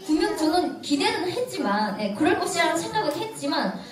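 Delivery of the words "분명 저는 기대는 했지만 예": ordinary talk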